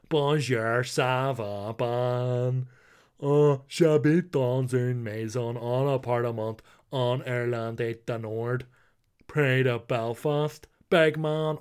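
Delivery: hammed-up Belfast-accented French, slow and drawn out. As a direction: imitate a friend's broad Belfast take on French. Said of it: "speaks French in a Belfast accent".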